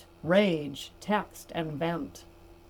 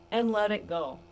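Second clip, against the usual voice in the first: usual speaking voice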